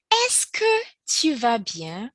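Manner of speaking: slow, careful French question; slow teacher demo; slow model question. Shown as a demonstration. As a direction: model it slowly for learners. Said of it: "start that question with".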